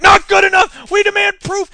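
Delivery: high, loud mock ranting demands; mocking frantic campaign. Mocking Brown's campaign hounding Warren, shrill ranting demand. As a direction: ham up a hysterical campaign demanding proof. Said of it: "And they're like".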